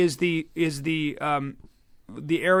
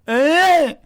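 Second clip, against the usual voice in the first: sharp forehand grunt, high and loud; tennis grunt; 10 semitones higher, 7 dB louder than usual. Shown as a demonstration. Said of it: "that's the noise I make when I, when I forehand".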